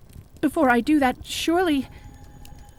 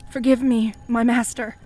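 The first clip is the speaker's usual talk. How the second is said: ordinary talk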